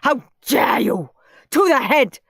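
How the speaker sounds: loud enraged order; furious shout. A narrator's reading. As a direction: shout him off to the head. Teacher furious.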